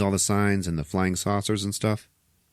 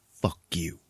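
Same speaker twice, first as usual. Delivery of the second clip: tag withheld; 3 semitones lower, 6 dB quieter than usual. Retold or imitated. Leans down over beaten man.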